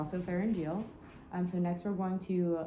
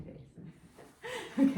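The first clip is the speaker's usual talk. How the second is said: laughing; 6 dB louder than usual. Real, not acted. Asking patient for a funny face.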